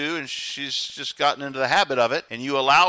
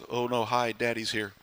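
put-on dreading kids; 7 semitones lower, 7 dB quieter than usual. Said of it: "it's like".

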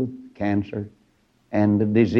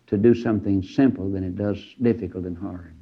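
usual speaking voice

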